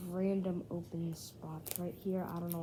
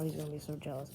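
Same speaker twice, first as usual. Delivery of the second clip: ordinary delivery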